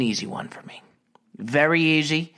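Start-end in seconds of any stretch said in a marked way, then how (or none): 0.0-0.8 whispered aside, a bit quicker; secretive whisper. Real, not acted. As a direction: lean in and whisper it.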